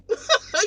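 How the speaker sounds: sudden high loud laugh; a loud cackle at her answer; loud burst of laughter. Real, not acted.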